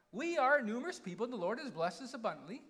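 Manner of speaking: quick, mock-whiny protest; hammed-up whiny boasting. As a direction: rattle it off as a whining complaint. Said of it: "I'm gonna read it in a whiny voice".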